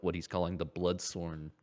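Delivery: normal talking voice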